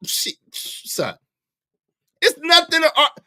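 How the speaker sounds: normal talking voice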